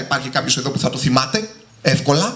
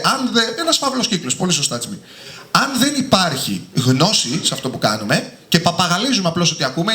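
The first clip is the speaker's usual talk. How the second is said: ordinary talk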